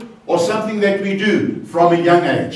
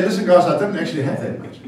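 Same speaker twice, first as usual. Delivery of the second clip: quick mock excuse; faster than usual. Imagined Jesus excusing himself.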